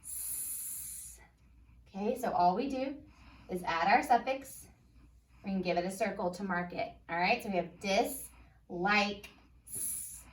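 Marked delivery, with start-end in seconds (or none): 0.0-1.2 s hissed with no voice, just air; whispered s sound. Shown as a demonstration. Teacher sounding out the letter s for kids. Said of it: "what did we say the suffix was". 9.7-10.3 s hissed with no voice, just air; whispered s sound. Shown as a demonstration. Teacher sounding out the letter s for kids. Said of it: "sounding out the letter".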